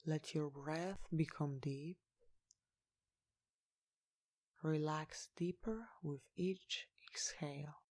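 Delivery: slow, soft meditation guidance with long pauses; guided meditation. Real, not acted. Guide leading listeners to relax each body part, slow meditation voice. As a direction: lead them gently into relaxation, unhurried and hushed.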